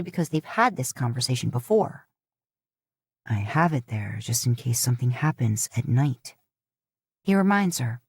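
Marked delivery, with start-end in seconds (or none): 3.3-6.3 low, patient reminder; a hint of a husband explaining; Dean's voice. A narrator's reading. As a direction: lower male voice. Dean reminds her.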